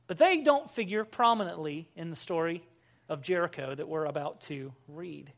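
ordinary talk

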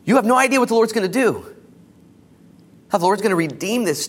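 loud, high, rising sermon call; preaching. Acted. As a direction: build the exhortation with fire. Rising exhortation to the congregation.

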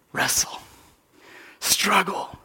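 soft whispered command; whispered urging. Acted. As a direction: whisper it as a plea.

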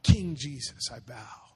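ordinary talk

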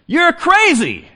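high, slow mock cry of crazy; playful sky-high drawn out you're crazy; mock exclamation. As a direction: stretch it out like calling someone nuts.

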